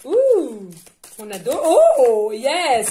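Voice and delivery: ouh aigu et fort, ravi; s'exclamer de joie quand la carte saute; cri de joie. Real, not acted. Une carte saute du paquet. (cri de joie ravi)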